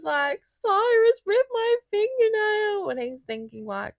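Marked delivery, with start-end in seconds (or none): 0.4-2.9 high, slow sobbing words; high slow mock sob; imitating crying. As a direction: blubber it through tears.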